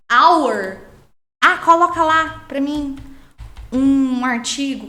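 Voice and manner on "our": our said louder in English. Shown as a demonstration. Teacher pronounces our in English. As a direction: give our its English sound, loud.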